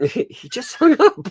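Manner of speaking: high helpless laughter; laughing. Real, not acted. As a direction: laugh through the words. Laughing retelling the hang-up.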